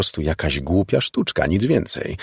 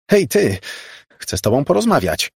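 high-pitched, raised calling voice; 8 semitones higher, 3 dB louder than usual. A narrator's reading. Margo shouts after the vanishing girl, high and loud. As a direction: call after someone getting away. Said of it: "zawołała".

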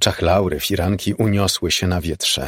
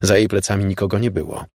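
ordinary delivery

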